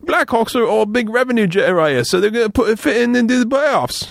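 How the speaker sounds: high mock British accent. Pitchman explains to sidekick, mock British accent. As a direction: keep the hammy British pitch going. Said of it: "I should do an accent for the commercial".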